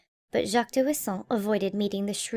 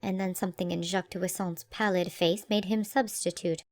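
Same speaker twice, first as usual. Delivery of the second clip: usual speaking voice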